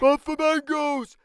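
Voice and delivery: high, loud excited shout; eager child voice. Acted. A sibling shouting a game idea, high and loud. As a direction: blurt it out eagerly. Character voice.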